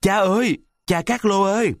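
high, panicked call for father; desperate cry for help. A narrator's reading. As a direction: cry out to Papa Carlo from under the stairs. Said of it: "chỉ kịp hét lên mấy tiếng".

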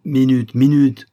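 usual speaking voice

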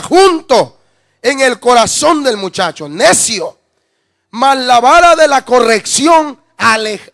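fuerte y lento, martillando cada frase; predicación a toda voz. Acted. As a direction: predica el versículo con fuego. Predica el versículo con fuerza. (predicación encendida a la congregación)

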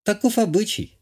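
high-pitched lady with a hint of a scoff; wry countess. A narrator's reading. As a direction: toss it off high, with mild wryness. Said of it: "хмыкнула графиня".

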